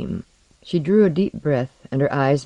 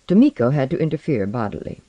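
ordinary delivery

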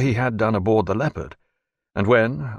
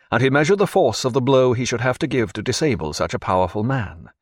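usual speaking voice

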